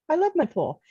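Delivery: high, playful sarcasm; sarcastically funny. Retold or imitated. Showing how the pool joke was said, sarcastically funny. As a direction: pitch it up in mock devotion. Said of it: "sarcastically funny".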